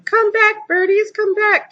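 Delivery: put-on high, slow little boy calling out; a toddler's high wailing call; toddler screaming. A narrator's reading. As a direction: call after the birds like a small child. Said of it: "was screaming".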